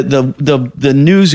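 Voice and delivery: ordinary talk, nothing marked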